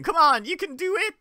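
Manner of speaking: high-pitched mock American cheerleading; American accent. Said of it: "She had a distinctly American-sounding voice".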